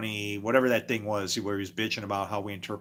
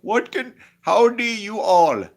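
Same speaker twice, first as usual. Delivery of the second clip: much higher imitated Indian accent on a Southern greeting; Indian accent with Southern words; 9 semitones higher, 8 dB louder than usual. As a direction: play a waitress in an Indian accent greeting you Southern-style. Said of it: "Hindi with a, with a Southern accent".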